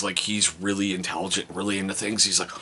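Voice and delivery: usual speaking voice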